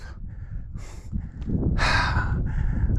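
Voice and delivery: long tired exhale; heavy sigh of exhaustion; weary sigh. Real, not acted. Exhausted on steep climb.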